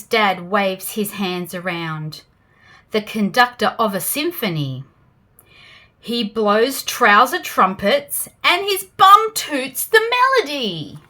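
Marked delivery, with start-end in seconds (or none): none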